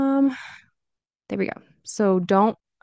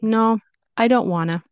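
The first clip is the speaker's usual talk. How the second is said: higher, casual refusal with "wanna"; lighter, less aggressive; 4 semitones higher, 4 dB louder than usual. Shown as a demonstration. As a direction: refuse lightly, words run together. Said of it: "But more light".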